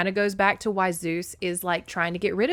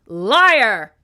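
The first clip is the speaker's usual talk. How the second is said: explosive shouted accusation; crying out; 4 semitones higher, 13 dB louder than usual. A narrator's reading. Furious mother accuses Zeus of lying, crying out. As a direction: hurl the word out very loudly. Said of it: "Demeter cried".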